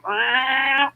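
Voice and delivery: a light cat meow; imitating a cat. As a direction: do the cat's chatty meow. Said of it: "you'll pet him and be like".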